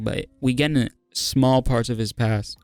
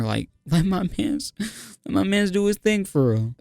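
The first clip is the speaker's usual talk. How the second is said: pleading, building; 7 semitones higher than usual. Real, not acted. Pleading with the fight to stop.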